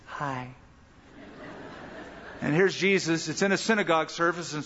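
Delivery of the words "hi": much softer, lower timid greeting. Pretend. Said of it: "there's Jesus".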